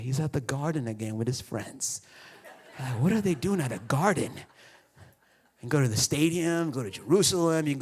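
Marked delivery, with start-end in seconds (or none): 0.0-2.0 hushed angels. Pretend.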